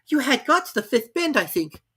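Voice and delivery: put-on helpful girl; Alice voice.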